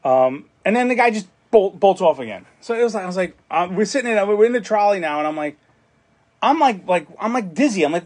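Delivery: ordinary talk, nothing marked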